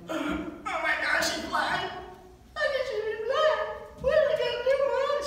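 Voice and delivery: hammed-up hysterical shock over a TV show; mock panic. Son panics about blind character.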